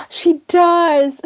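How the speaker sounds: real excited reveal; delighted gasp. Real, not acted. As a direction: burst out with gleeful confession. Delighted reveal of dog's Instagram.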